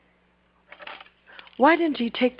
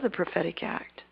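hushed whispered aside to the church; 6 semitones lower, 12 dB quieter than usual. Acted. Preacher confiding to congregation, whispered. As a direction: lean in and whisper it.

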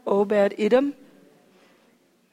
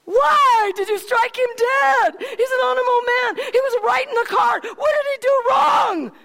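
furious shout; 13 semitones higher than usual. Acted. David shouting angrily at God over Uzziah's death. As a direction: hurl the question up at God, outraged.

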